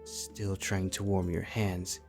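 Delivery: plain reading voice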